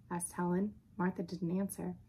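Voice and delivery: ordinary delivery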